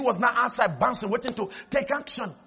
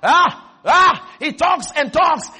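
loud and higher; preaching shout; 4 semitones higher, 9 dB louder, slower than usual. Real, not acted. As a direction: build it up to the crowd. Preaching climax.

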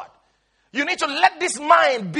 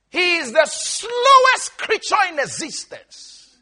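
ordinary delivery

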